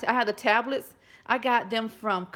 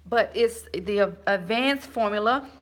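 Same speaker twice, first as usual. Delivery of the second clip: normal talking voice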